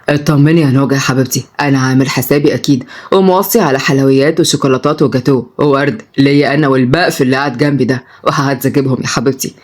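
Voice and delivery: put-on low, smooth young man; composed, low-pitched grandson; reassuring grandson.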